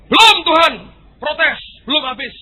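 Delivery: high, loud objection; loud insistence. Acted. The human loudly insists God still has stock. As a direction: cut in loudly.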